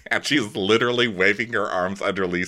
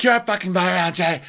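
high-pitched indignant jab; mock angry accusation; 9 semitones higher than usual. [name] calls Angie a liar, mock angry accusation. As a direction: throw the accusation in a raised pitch.

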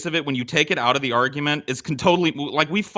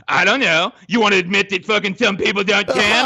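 higher, louder mock taunt; taunting friend; 5 semitones higher, 4 dB louder than usual. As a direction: needle him about tanning in his voice. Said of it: "And you're like".

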